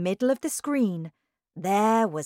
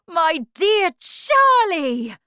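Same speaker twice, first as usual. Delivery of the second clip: high, loud, drawn-out delight; joyful cry; 12 semitones higher, 11 dB louder, slower than usual. A narrator's reading. Wonka greeting his winner, crying out joyfully. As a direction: cry out slowly, overjoyed. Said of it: "cried Mister Wonka".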